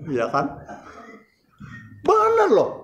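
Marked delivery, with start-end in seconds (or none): 2.0-2.7 emphatic. Real, not acted. Emphatic aside.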